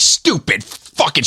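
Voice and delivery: high, slow spat curse; mock furious mother. Mother cursing the drive-through worker while throwing food, high and slow. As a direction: hurl the insult with the food.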